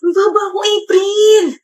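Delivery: high, whiny cry of dismay; dismayed wail. Acted. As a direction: wail to a friend, hammed-up. Wails over low quiz score.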